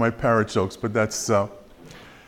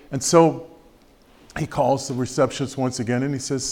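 ordinary delivery

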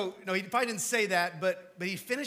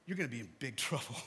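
quick, lower, a laugh breaking into the warning; 7 semitones lower, 4 dB quieter, faster than usual. Real, not acted. Pastor warning the congregation with a laugh. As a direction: chuckle through the threat. Warning about judgment day.

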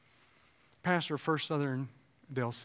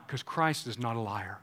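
usual speaking voice

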